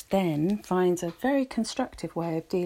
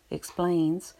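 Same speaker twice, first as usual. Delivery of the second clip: plain reading voice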